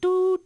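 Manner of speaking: high, sharp mouth-noise gunshot; imitated gunshot. Imitating drunk dad shooting during a flashback, high squeak. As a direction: squeak out a toy-gun blast. Imitating dad's gun shot.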